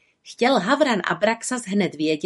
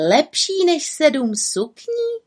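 high, slow astonished question; puzzled little witch; 8 semitones higher, slower than usual. A narrator's reading. Little witch echoes the raven, puzzled and high. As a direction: ask it in disbelief.

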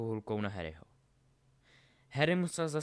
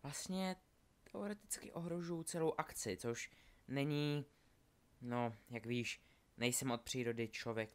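Delphi, hesitant and chatty; 2 semitones higher, 5 dB quieter than usual. Acted. Delphi explains herself to Scorpius in Hogwarts, hesitant. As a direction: ramble softly and lightly, hesitating.

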